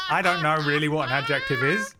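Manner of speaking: laughing through the words. Real, not acted. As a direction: laugh it out, embarrassed. Laughing at own ignorance.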